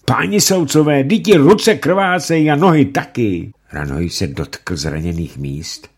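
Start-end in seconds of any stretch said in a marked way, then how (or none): 0.0-3.5 high, loud desperate shout. Acted. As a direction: scream it at the judges in horror. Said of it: "třásl a křičel".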